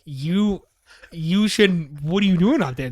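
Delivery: retold incredulous questioning; incredulous scolding. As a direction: act out stammering disbelief, pitch up. Imitating dispatcher scolding him in disbelief.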